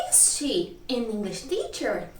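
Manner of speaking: slow "Is she an English teacher" with a questioning lilt; teacher's measured question tune on "Is she an English teacher"; question intonation. Shown as a demonstration. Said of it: "quando é pergunta é o mesmo tipo".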